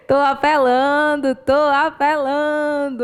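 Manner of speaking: drawn-out, hammed-up begging; milk it like a shameless plea; playful sing-song confession; joking begging.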